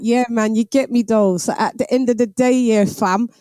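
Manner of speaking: animated London roadman talk; London street slang accent. Shown as a demonstration. Londoner demonstrating Top Boy talk, London street accent. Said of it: "So they talk like".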